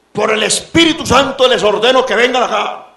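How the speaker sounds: high, loud mock command; mimicked church sister. Preacher imitating church sisters summoning people by force, mock commanding voice. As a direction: imitate a sister ordering people forward in the Spirit's name. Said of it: "he escuchado yo hermanitas".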